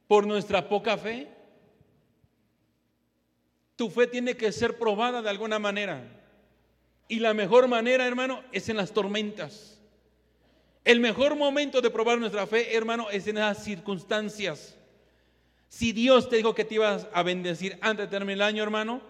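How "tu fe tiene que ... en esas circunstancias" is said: ordinary delivery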